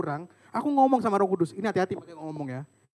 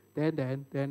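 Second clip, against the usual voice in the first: usual speaking voice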